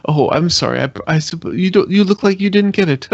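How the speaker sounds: high, amused nudge to the neighbour; nudging audience member. Pretend. Character elbows the neighbour who missed the joke, high and amused. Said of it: "elbow the guy next to me".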